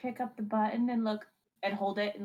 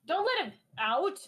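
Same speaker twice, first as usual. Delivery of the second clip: alarmed; 8 semitones higher than usual. Real, not acted. Panicked warning to party.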